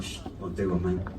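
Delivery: very soft, low, dismissive hush; hushed cat. Pretend. The stray cat hushes the jealous dog, barely audible. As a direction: brush the dog off under your breath. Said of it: "This cat's like".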